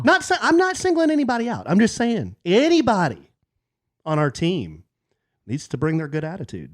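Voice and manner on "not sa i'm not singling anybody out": mock innocence. Teasing hint at daughter.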